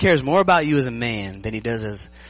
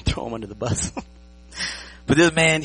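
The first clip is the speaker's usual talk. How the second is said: ordinary talk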